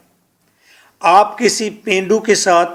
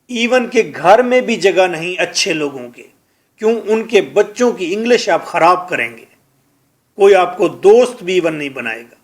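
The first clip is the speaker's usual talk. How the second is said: ordinary talk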